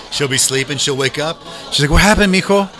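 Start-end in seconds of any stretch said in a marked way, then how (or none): 1.9-2.7 worried mom. Retold or imitated. Mom woken by late call.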